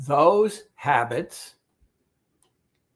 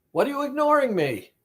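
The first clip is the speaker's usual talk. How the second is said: high, fast, hurt whine of the ignored craving; offended inner voice; 9 semitones higher, much faster than usual. Retold or imitated. Therapist voicing the client's ignored craving, high and fast. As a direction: play the neglected voice sulking. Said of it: "that's how the voice feels".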